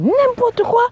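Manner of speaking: high, scornful brush-off; scoffing dismissal. Real, not acted. Coach mocking a smooth talker, scornful.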